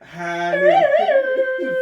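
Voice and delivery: very high, loud, stretched out; cowboy showdown call. Pretend. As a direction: belt out high noon dramatically. Dramatic duel call.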